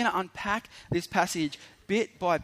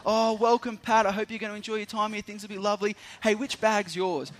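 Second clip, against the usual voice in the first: higher-pitched, eager camp host; gushing welcome; 5 semitones higher than usual. Retold or imitated. Camp worker welcomes the new teacher, gushing and higher-pitched. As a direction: make the newcomer feel like an honoured guest.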